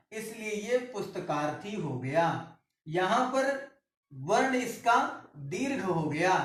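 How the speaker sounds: normal talking voice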